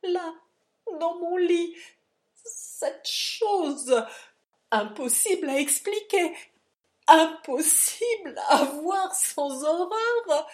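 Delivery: high, loud tearful horror; sobbing. A narrator's reading. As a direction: sob it out in horror. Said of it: "sanglota Silas". (sanglots horrifiés, aigus et forts)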